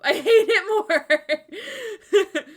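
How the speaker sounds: slow, high, loud laughing complaint; mock despair while laughing; laughing groan. Real, not acted. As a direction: drag it out through laughter. Reacting to wrong age text.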